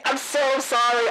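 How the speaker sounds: usual speaking voice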